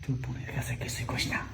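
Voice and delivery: low conspiratorial whisper. Acted. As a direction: keep it hushed and secretive. Secret talk, wife nearby.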